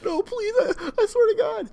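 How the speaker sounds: high-pitched mock begging for mercy; mock pleading. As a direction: beg as if being tortured, hammed-up. Pleading while being waterboarded.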